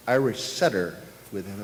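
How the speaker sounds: normal talking voice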